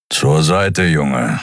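grumbled brush-off in character; lightly voiced grumbler; gruff grumble. A narrator's reading. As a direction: grumble it at a boy in your way. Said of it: "brummte er".

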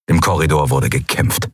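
usual speaking voice

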